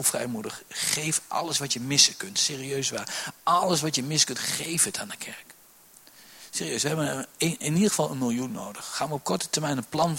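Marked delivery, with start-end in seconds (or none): 4.5-5.4 whisper. Real, not acted. Whispered aside in appeal.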